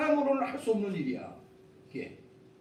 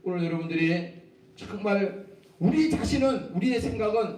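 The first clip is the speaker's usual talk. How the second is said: ordinary talk, nothing marked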